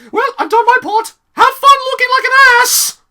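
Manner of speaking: very high, loud sneering put-on voice; hammed-up smug jab; snooty mock voice. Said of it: "Mavis is like".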